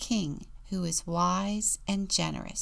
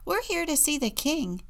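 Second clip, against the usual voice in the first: higher quoted Jack voice; quoting Jack; 8 semitones higher than usual. Retold or imitated. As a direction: retell it lightly as eager Jack. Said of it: "castle gates and say".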